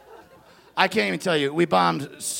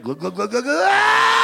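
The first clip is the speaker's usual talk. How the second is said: gulping juice, refreshed ah; 10 semitones higher than usual. Retold or imitated. Kid drinking juice, glugging then gasping. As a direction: gulp it down then gasp in delight. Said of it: "they're like".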